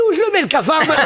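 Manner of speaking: laughing at the cockroach punchline. Acted. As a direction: deliver it giggling.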